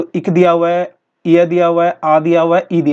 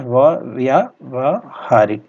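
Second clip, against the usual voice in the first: "व्यवहारिक" drawn out slowly, syllable by syllable; slow spelling dictation; 3 semitones lower, much slower than usual. Shown as a demonstration. Teacher to students, slowly spelling out "व्यवहारिक". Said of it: "व्यवहारिक ऐसे नहीं लिखते हैं".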